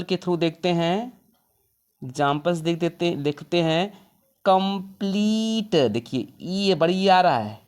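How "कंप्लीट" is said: कंप्लीट said slowly with a long ee; slow model word. Shown as a demonstration. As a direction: stretch कंप्लीट out as kəm-pleet, long ee sound. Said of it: "इसको pronunciation करेंगे".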